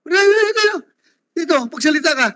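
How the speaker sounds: high-pitched summons across the room; real, insistent call to someone in the crowd. Real, not acted. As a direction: call them up to speak here. Chair summons person who shouted.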